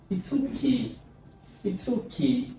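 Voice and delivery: IT'S OKAY lifted high, tentative; fall-rise partial agreement. Shown as a demonstration. Teacher showing reluctant agreement, high tentative tone. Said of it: "or partial agreement".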